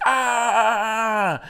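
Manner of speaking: long, high, loud charging yell; acted war cry held out on one breath; battle-charge scream. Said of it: "and he's like".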